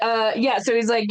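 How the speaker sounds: normal talking voice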